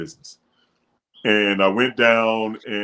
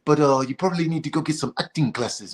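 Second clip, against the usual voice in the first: higher-pitched imitation of the producer; radio producer; 5 semitones higher than usual. Producer sending a new reader to acting classes, higher imitated voice. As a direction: mimic him letting you down in a higher voice. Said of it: "he's like".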